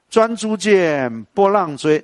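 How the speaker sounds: drawn-out verse chant; slow recitation. Shown as a demonstration. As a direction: stretch each syllable as in recital. (拖长吟诵)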